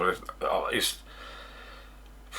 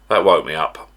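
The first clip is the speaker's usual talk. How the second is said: ordinary talk, nothing marked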